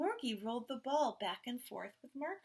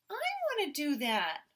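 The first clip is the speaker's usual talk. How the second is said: put-on eager child; 6 semitones higher, 6 dB louder than usual. A narrator's reading. Twin eager to join.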